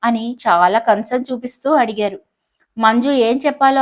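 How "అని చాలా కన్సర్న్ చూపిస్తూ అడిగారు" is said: ordinary delivery, nothing marked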